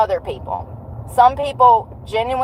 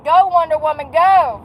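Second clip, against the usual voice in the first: mock cheering; 7 semitones higher, 3 dB louder than usual. Runner mocking a watch's absurd speed reading, mock cheering. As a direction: cheer on a superhero in mock excitement.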